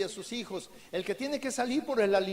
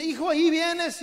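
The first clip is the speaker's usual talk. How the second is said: calling out; 6 semitones higher, 6 dB louder than usual. Acted. Father calling back in snow.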